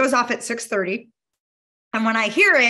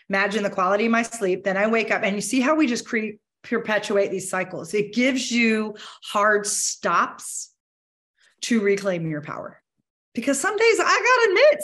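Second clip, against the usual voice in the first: normal talking voice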